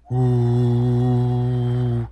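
long, steady "ooh" drone; imitated fan whir. Hearing fans outside the new apartment, imitated steady whir. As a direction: hum like fans heard through a door. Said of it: "I don't know how that comes out on the mic".

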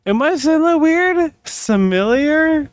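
slurring a little, tipsy. Real, not acted. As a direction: talk like you've had a few. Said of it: "I'm drunk".

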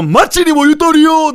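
a slow, soaring mock rallying cry; slow loud cry. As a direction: draw the slogan out as a slow cry. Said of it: "タイミングと声量と声質の妙".

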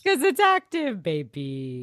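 sassy echo. Real, not acted. Echoing with sass.

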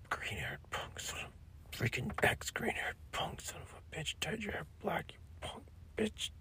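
high whispered insults; whispered rant. Pretend.

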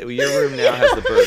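high, loud laughing line. Pretend. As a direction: break into laughter mid-line. Plays the parent, laughing.

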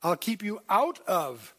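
slow, "out" leaned on; stress on out. Shown as a demonstration. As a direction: hit "out of" slowly. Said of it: "a preposition meaning out of".

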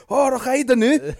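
high, loud mock Scottish; hammed-up Scottish accent, pitched right up and loud. Imitating a workmate on the phone, exaggerated Scottish accent. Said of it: "he just airs a Scottish accent".

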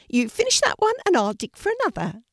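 high, loud little rabbit voice; gentle rabbit calling out to a scared puppy; bright high rabbit, loud. A narrator's reading. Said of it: "said the rabbit".

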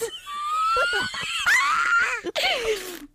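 shrieking burst of laughter. Real, not acted. Big laugh at the reading.

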